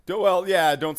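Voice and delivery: real loud exclamation; loud outburst. Real, not acted. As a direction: blurt it out loud. Emphatic warning.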